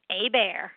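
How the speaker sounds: Hebert said AY-bear, silent H and T; Cajun French pronunciation. Shown as a demonstration. Guest teaching host her surname, Hebert as Cajun French AY-bear. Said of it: "it's Cajun French".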